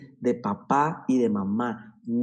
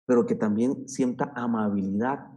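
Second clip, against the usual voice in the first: ordinary talk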